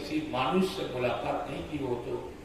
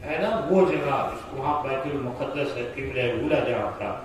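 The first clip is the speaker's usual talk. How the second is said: ordinary talk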